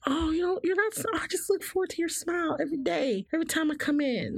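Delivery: high, sugary mock compliment; imitating doting customer. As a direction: gush about her daily smile. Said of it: "people will be like".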